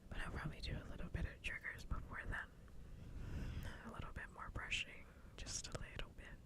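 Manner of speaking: ASMR whisper. Real, not acted. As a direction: whisper it softly to the listeners.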